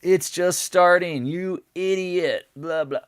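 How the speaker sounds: high, loud, slow mock yell. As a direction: yell it like an angry Discord member. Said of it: "yelling at me like".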